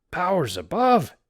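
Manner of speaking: high, loud cry of alarm; frightened cry. Acted. Sailor seeing another figure at the gate, frightened cry. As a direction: blurt it out in fright. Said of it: "cried Pat Crowe".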